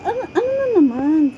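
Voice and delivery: high, exasperated what-now; mock exasperation at being scolded again; exasperated retort. To her convent scolders, an exasperated what now. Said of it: "Sabi ko".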